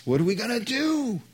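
high, fast, panicky question; panicked servant. Acted. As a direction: squeak it out in rising alarm. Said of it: "He's panicked".

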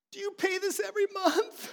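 put-on high, laughing child's voice asking in disbelief; kid's shocked question. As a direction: play the stunned kid, half laughing. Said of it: "She's like".

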